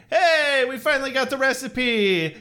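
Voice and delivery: giddy aliens. Retold or imitated. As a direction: gush like a villain who finally won. Aliens celebrating with the recipe.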